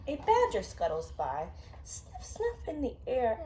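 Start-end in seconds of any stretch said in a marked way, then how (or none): none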